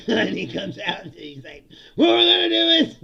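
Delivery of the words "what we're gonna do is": high, very loud stage shout; hyped company president. Acted. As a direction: parody a president firing up the room. Mimicking company president's stage hype.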